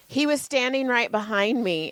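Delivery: ordinary delivery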